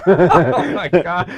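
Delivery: loud, high burst through laughter; laughing. Real, not acted. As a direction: crack up while saying it. Cracking up at the question.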